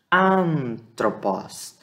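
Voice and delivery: Antropos repeated with a drawn-out nasal AN; slow pronunciation demo. Shown as a demonstration. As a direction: hold the first vowel of Antropos nasally, end on open POS. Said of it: "ele tem um som nasal".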